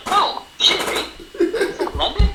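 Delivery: high breathy mock awe at London. Impressed locals hearing British talk, in a high mock gasp. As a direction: gasp it high like starstruck fans. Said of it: "and they're like".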